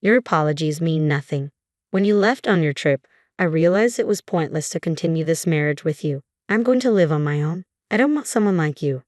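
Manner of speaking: usual speaking voice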